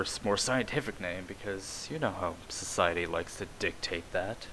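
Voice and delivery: ordinary talk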